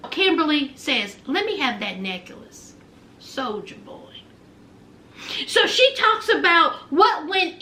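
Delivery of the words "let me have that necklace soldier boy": low, hushed demand; quiet demand. Retold or imitated. Girlfriend demanding the necklace from her boyfriend, low and quiet. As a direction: drop low and soft, trailing down.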